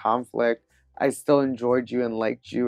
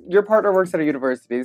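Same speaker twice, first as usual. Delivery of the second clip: usual speaking voice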